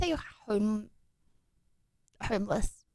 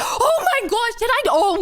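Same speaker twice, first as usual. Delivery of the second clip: sudden gasp of surprise; 8 semitones higher than usual. Real, not acted. Sudden memory, gasps.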